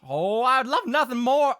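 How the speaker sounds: high Southern character voice gone wild; off-target character voice. Acted. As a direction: overshoot a Southern accent. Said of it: "that's not the voice".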